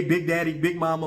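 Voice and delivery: ordinary talk, nothing marked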